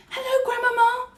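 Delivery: tiny high mouse voice, lightly hushed; whispered greeting. A narrator's reading. Mouse greets Grandmama from her lap, whispering. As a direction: squeak a hushed hello. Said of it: "I whispered".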